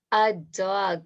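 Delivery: ordinary talk, nothing marked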